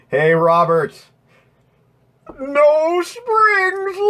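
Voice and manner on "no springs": squeaky silly voice. Pretend. Silly high voice to viewer.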